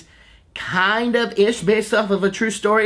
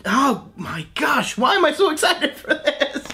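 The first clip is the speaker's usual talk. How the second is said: high, laughing disbelief at himself; laughing excitement; 9 semitones higher than usual. Real, not acted. Fan laughing at his own excitement over the movie. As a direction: giggle through it, amazed at himself. Gushing about the Pooh movie.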